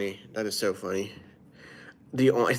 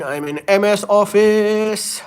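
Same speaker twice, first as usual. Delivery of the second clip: playful punchline; 11 semitones higher, 8 dB louder than usual. Pretend. Punchline after gang joke.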